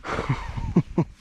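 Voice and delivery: short breathy disbelieving laugh; a quick stunned chuckle; shocked laughter. Real, not acted. Botched cast, stunned.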